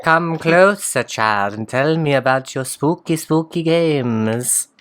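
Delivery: slow, looming storyteller hag; creepy voice. Acted. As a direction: draw them in like a witch by the fire. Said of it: "That was quite creepy".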